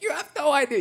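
high-pitched mock choking up; imitating tearful woman. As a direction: play her on the edge of tears. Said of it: "starts losing it".

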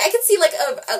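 usual speaking voice

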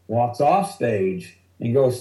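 ordinary talk, nothing marked

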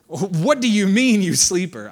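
loud, drawn-out mock rebuke; scolding. Captain to sleeping Jonah on the boat, loud scolding. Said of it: "they're scolding him".

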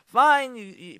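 loud, high single word; exasperated fine. Retold or imitated. Comedian venting about husband's choice, loud. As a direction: throw the word out, fed up.

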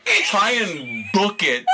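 normal talking voice

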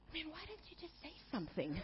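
soft, calm, puzzled question; mild calm husband. Retold or imitated. Husband to wife, softly baffled and calm. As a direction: ask it gently and simply. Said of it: "quite calmly".

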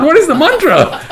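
very high, baffled question; acted desperate search for forgotten words; comic helplessness, pitched right up; trying to figure out. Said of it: "he's trying to figure out".

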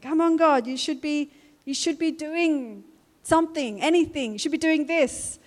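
exasperated plea to God. Retold or imitated. Preacher voicing her own frustrated prayer, exasperated plea. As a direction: demand that God do something now.

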